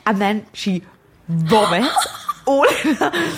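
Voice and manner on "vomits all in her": laughing through the words, slow and higher; cracking up. Real, not acted. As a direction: struggle to tell it through real laughter. Cracks up telling gross part.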